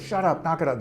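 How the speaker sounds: brief acted burst of irritation; mad. Angry caregiver answers a crying baby, mock mad. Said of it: "they come in mad".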